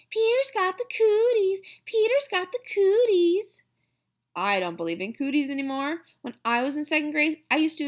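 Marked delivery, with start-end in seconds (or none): 0.1-3.6 high, loud playground taunt, sung out twice; taunting yell. A narrator's reading. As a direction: tease him like a schoolyard chant, loud and high. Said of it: "she yells".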